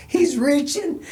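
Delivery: high-pitched mock British alarm; imitated British accent. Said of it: "in his accent".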